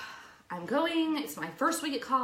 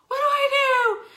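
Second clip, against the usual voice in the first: high, loud panicked cry; panicked shout; 11 dB louder, faster than usual. Retold or imitated. Panicked thought, retold.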